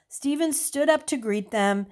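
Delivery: usual speaking voice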